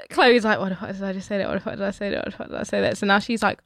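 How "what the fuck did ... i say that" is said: quiet, repeated mutter to oneself; muttered self-reproach. Retold or imitated. Storyteller voicing the girl's regret in her head, muttered quietly. Retelling Chloe's panicked inner thoughts.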